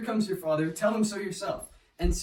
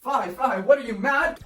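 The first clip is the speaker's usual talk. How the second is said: higher, much louder cry of protest; shocked outcry; 7 semitones higher, 9 dB louder than usual. Acted. Mother shouts at her raging husband, shocked and loud. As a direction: cry out to stop him.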